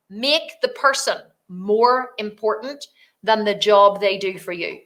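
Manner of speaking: slow, deliberate delivery of a rule; emphatic rule. Real, not acted. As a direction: land it word by word. Stresses her rule, then repeats it.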